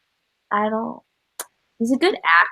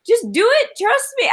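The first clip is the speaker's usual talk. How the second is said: high-pitched pushy pitch; 13 semitones higher than usual. Pretend. Pitching the flipping table.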